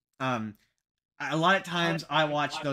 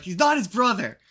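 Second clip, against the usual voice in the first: high, loud imitated protest; playfully object, raised in pitch and volume; imitating a fed-up correction; mock exasperated correction; 6 semitones higher, 6 dB louder than usual. Acts out co-host's exasperated correction.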